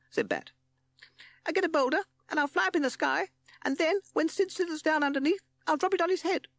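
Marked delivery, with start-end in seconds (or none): 1.5-6.5 very high, loud squeaky bat; excited bat. A narrator's reading. Bat pitching his boulder plan, excited squeak.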